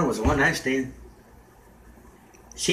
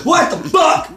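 much higher, loud startled shout; shocked shout; 12 semitones higher, 4 dB louder, slower than usual. Retold or imitated. Hearing something at the tree, a high startled shout. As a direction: yell it out in alarm.

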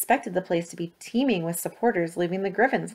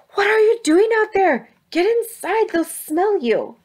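frantic; 13 semitones higher, 6 dB louder than usual. Acted. Ethel frantic at window.

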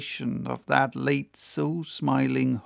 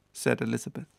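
plain reading voice

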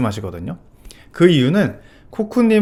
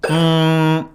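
steady ng hum on one note; sustained closed ng sound; held ng hum; much slower than usual. Shown as a demonstration. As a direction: hum an ng as a vocal coach's demo. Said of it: "요런 소리가 붙게 되는데".

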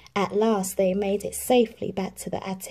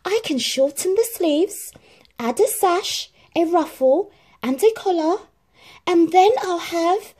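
high, louder, building excitement; excited Cinderella; 11 semitones higher, 6 dB louder than usual. A narrator's reading. Cinderella plans her gown, rising excitement. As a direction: list the ideas faster with joy.

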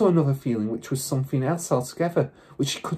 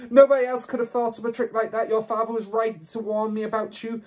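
high-pitched maniacal rant; 10 semitones higher than usual. A narrator's reading. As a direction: rant like she has lost control. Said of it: "ranting like a maniac".

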